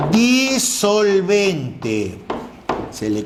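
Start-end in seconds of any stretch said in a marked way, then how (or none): 0.1-1.4 disolvente stretched slowly, each syllable held; slow teacherly emphasis on disolvente; drawn-out term. Shown as a demonstration. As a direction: draw out disolvente like a key word on the board. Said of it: "se le conozca como tilde".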